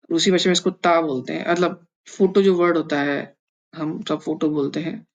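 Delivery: ordinary talk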